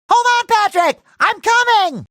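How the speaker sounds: high-pitched rescue call; urgent calling out. Acted. Hero calls to Patrick that he's coming, urgent high call. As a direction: call out urgently to a friend in trouble.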